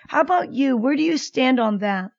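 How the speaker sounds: ordinary delivery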